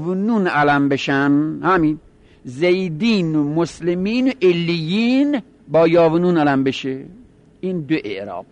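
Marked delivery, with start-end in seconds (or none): none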